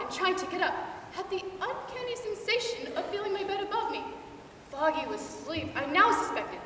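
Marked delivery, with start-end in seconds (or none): none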